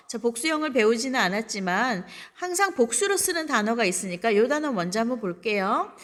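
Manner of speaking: normal talking voice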